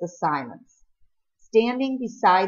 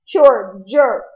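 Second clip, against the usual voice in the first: sobbing; 5 semitones higher, 12 dB louder than usual. A narrator's reading. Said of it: "Peter sobbed".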